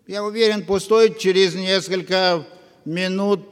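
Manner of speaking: ordinary delivery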